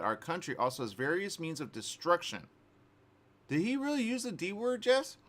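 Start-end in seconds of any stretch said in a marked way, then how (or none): 3.5-5.2 high-pitched disbelief; astonished, pitch jumping up; incredulous. Real, not acted. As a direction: question it as if you can't believe it. Host reacting in disbelief to Putin quote.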